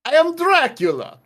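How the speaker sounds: high, theatrical vampire accent; Dracula accent. Shown as a demonstration. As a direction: announce it like Count Dracula. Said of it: "it is Dracula's accent".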